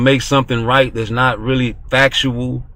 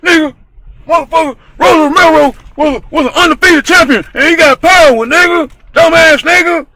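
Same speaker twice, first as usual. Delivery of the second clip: much higher, much louder screaming rant; screamed version; 9 dB louder than usual. Shown as a demonstration. Screaming it on the debate show. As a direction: scream it at the other panelist. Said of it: "Or I can say".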